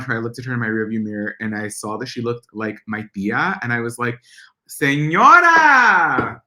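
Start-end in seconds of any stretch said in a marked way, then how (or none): 4.7-6.4 long drawn-out loud call, higher. Retold or imitated. Calling out to a slow driver, long and loud. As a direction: stretch it out and belt it at her.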